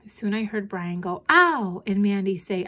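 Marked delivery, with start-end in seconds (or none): 1.3-1.8 short high, loud "ow"; sudden pained yelp, pitched up. A narrator's reading. Boy touching something hot, yelping. Brother burns his hand.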